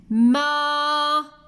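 ماء as long held maa, pause, then un; slow teacher's breakdown of ماء into maa and un; split syllables. Shown as a demonstration. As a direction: hold the maa of ماء steadily, then a separate un. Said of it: "لاحظوا أين سمعتم صوت الهمزة".

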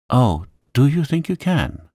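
high-pitched, flustered question; gasping. A narrator's reading. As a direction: gasp it out in hopeful disbelief. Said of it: "she gasped".

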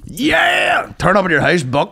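loud hyped-up call; hype shout. Pretend.

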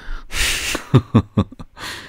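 breathy voiceless chuckle; laugh. Real, not acted. Teacher remembers an old student, airy laugh. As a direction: laugh airily in a run of soft puffs.

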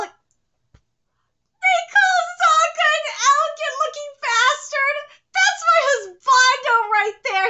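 high, loud, gushing indignation; excited fan outburst. Real, not acted. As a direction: burst out loudly over your favourite. Outburst defending a favourite character.

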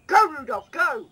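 loud high rallying shout; rousing cheer. Pretend. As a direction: urge him on like a coach. Cheering on reindeer.

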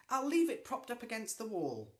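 high, loud falsely friendly call. Acted. Ethel calling to Mildred with fake friendliness. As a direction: call sweetly across the yard. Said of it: "called Ethel".

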